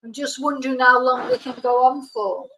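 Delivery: usual speaking voice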